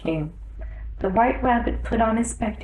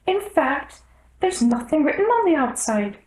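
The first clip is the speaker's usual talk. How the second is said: much higher, fussy rabbit voice; anxious little rabbit pleading to the king; 8 semitones higher than usual. A narrator's reading. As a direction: squeak it out in a flustered hurry.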